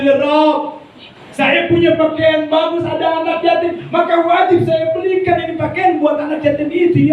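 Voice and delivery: a devout man pleading for orphans in prayer, high and earnest. Acted. Soft-hearted man's voiced prayer.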